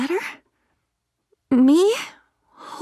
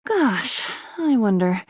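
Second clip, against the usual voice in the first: usual speaking voice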